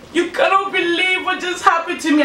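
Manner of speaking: high mock whimper to a flatmate; retold tearful call. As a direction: imitate a weepy phone call, pitched high. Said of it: "I'm in McDonald's crying".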